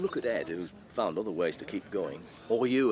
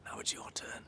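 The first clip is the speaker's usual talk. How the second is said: quick whispered prompt; whispering; 11 dB quieter than usual. Acted. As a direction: whisper the cue softly. Prompting during church service.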